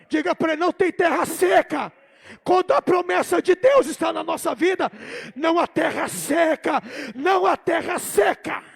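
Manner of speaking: loud, insistent declaration; full-force preaching. Real, not acted. Preacher telling the congregation there is no dry land, forceful shout. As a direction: proclaim it forcefully, hammering the repeats.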